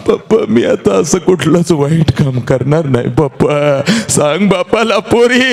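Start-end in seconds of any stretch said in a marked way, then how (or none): none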